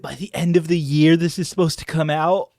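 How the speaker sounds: loud, excited exclaiming; loud fan excitement; thrilled outburst. Real, not acted. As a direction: burst out with it, barely believing the news. Thrilled by the release date.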